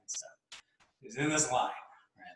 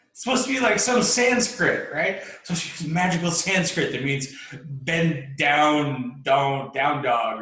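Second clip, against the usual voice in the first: loud, high-pitched exasperated protest; indignant disbelief; 5 semitones higher, 7 dB louder, slower than usual. Acted. Let-down seeker who expected magical Sanskrit, indignant. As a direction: protest loudly, stretching the key words.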